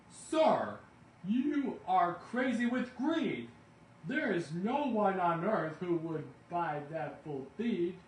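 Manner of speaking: high, loud, slow furious scolding; furious Lorax. A narrator's reading. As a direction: shout down his greed. Lorax scolding the greedy Once-ler.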